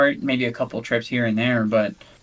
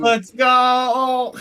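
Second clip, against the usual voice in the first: high excited cheer; triumphant cheer; 13 semitones higher, 5 dB louder than usual. Real, not acted. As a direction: cheer it loudly. Celebrating the clutch.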